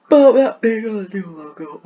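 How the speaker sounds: words stretched through a big real yawn. Real, not acted. Vlogger apologizing to viewers while yawning. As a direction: yawn right through the line. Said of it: "I don't know why I'm yawning so much".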